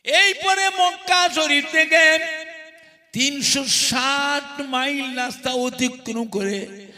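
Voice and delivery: loud, slow, ringing sermon peak; impassioned waz preaching, loud; preaching at full force. Acted. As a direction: build the longing toward the climax.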